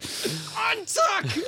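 high-pitched strained yell; frustrated yell. Retold or imitated. Man fighting stuck bed sheets, frustrated yell. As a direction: strain it out, acting out a struggle.

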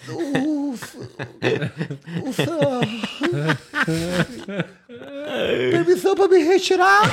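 stammered, high, half-laughing; laughing through a stutter. Retold or imitated. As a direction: stumble over it while cracking up. Soldado gago, rindo. (soldado gaguejando e rindo)

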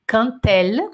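"quand elle" linked with a T sound, kant-el; T liaison demo. Shown as a demonstration. Teacher showing the class the T liaison. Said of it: "som de T".

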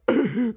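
a quick, high little laugh; short chuckle. Acted. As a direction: chuckle to yourself, pleased. Geppetto pleased with his idea. (risatina compiaciuta)

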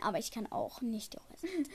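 whispered, trailing off; whispered aside. Real, not acted. Podcast host whispering she can't stay calm. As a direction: breathe it as a confession.

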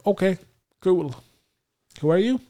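comic high, loud bewilderment; high-pitched clueless reply. Retold or imitated. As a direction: play yourself woozy and clueless, voice shooting up high. Retold groggy reply to doctor.

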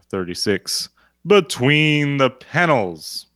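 low, booming promo announcer voice; wrestling promo. Shown as a demonstration. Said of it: "[name], do the promo".

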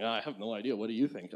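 lightly voiced baffled doctor, soft and low; clueless ER doctor. ER doctor standing over a patient with a rare disease, lightly imitated. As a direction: toss it off softly as an unhelpful doctor. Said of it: "the E R doctor's standing it over you going".

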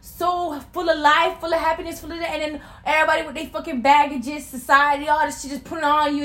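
high, loud and earnest; fired-up sincere plea; impassioned. Real, not acted. Impassioned speech about childhood innocence.

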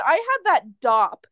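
ordinary talk, nothing marked

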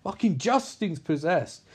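usual speaking voice

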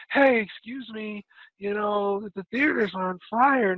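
imitating a politely timid warning, drawn out; overly polite calm. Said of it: "You can't be like".

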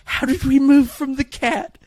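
high baffled disbelief; incredulous. Retold or imitated. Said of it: "I was like".

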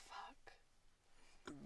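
breathy whisper, barely there; whispered curse. Pretend. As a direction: drop to a whisper for the swear. Acting out saying only profanity.

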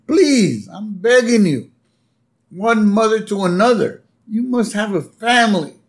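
a mother begging another mother; pleading Marge. Retold or imitated. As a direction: plead with the judge. Marge begs the judge.

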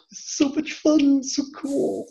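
high, slow, breathless gushing; gushing fanboy. Retold or imitated. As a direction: swoon over it like a starstruck fan. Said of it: "just sitting there going".

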